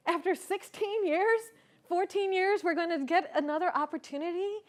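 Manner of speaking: overjoyed, hardly believing it; thrilled at a second chance with his brother; ecstatic disbelief. Retold or imitated. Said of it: "I was ecstatic".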